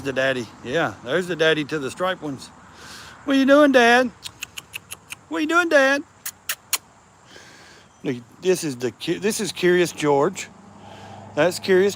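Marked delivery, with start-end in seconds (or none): 3.2-6.0 high, loud cat-calling voice; high playful call. Real, not acted. Man calling to the daddy cat playfully. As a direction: holler sweetly at the tomcat.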